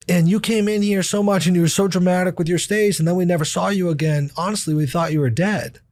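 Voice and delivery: a touch of an astonished nurse; nurse's voice. Retold or imitated. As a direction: voice the nurse who remembers him. Retells the nurse remembering him.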